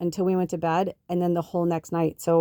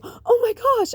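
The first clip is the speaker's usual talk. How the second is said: a very high squealed oh my gosh; stunned delight; 3 dB louder, slower than usual. Retold or imitated. Retelling her shock at the candle gift, high squeal. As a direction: squeal it like the gift just landed.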